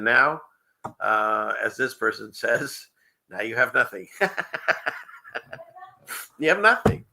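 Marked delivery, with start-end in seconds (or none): 6.4-7.0 high, louder mocking echo with a rising end; mock repeat. Host echoing a viewer's comment for laughs, high mocking repeat. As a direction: repeat the punchline pitched way up.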